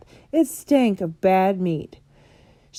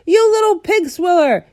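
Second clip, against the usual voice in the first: furious roar; 11 semitones higher, 8 dB louder than usual. A narrator's reading. Bloodbottler roaring at the BFG, furious and loud. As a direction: bellow the insult. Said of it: "roared the blood bottler".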